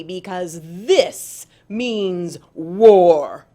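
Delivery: slow, steady threat building to a loud declaration; a small creature daring a bully; defiant challenge. Acted. Armadillo warning Tiger, slow and defiant. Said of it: "Tiger looked amused".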